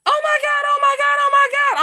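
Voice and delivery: shrill, very high, loud panic; panicked sister. Retold or imitated. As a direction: mimic the sister freaking out on the phone. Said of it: "she's like".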